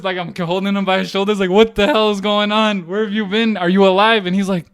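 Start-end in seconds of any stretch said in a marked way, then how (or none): 1.5-4.3 loud, high demanding questions; frantic confrontation. Retold or imitated. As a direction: act out accosting a friend in alarm. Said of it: "rolled up like a crazy person".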